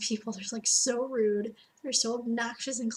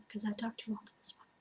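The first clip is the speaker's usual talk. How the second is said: whispered aside. Real, not acted. Aside ending the video.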